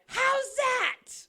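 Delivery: loud, high shout at the umpire; screaming appeal. Shown as a demonstration. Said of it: "You'd be screaming into the umpire".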